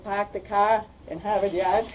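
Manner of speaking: Boston accent with dropped R's. Shown as a demonstration. Speaker joking to audience about hometown speech, Boston accent. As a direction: slur the R's away like a New Englander. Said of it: "We slur our R's a little bit".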